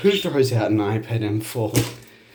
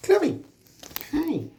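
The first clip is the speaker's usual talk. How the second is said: much higher, sharp call of the name. Real, not acted. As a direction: call the bird to stop. Calling the budgie.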